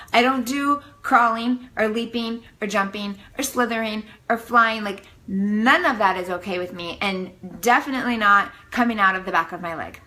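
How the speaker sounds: ordinary delivery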